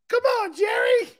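high, loud shout; imitating Cuba Gooding. Imitating Cuba Gooding yelling at Jerry, high and loud. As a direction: yell it far up in the voice. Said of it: "Cuba Gooding starts going".

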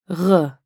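"r" rasped far back in the throat; French R. Shown as a demonstration. Teacher shows learners the French R, back in the throat. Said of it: "Try to pronounce it far back in the throat".

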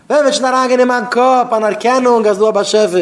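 high, loud exclaiming; impassioned cry. Acted. Speaker urges recognition, impassioned loud cry. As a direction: push the voice high and loud throughout.

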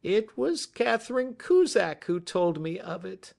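high, distressed little man; cracking voice. A narrator's reading. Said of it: "he said in a cracking voice".

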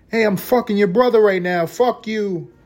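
put-on snotty high girlfriend voice; mocking girlfriend. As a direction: taunt him like a cheating girlfriend.

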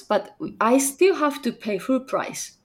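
ordinary talk

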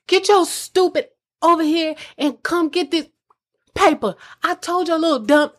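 imitating someone berating. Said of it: "they're saying stuff to me like".

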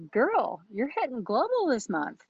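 excited announcement. Retold or imitated. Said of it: "And I said".

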